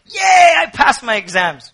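high, loud celebratory yell; cry of joy. Acted. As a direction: cheer like you just passed. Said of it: "a cry of joy".

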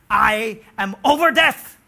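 loud, slow proclamation; loud slow triumphant words; triumphant slow declaration. Acted. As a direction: declare victory over death. Death's conqueror voiced loudly.

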